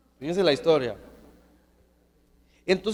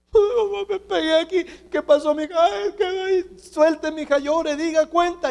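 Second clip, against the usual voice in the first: put-on much higher whiny child's voice and the mother answering; little girl whining to mom; 10 semitones higher than usual. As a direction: play the crying little girl and her mom, high. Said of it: "le enseñaron a llorar y a hablar".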